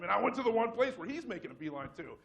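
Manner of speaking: usual speaking voice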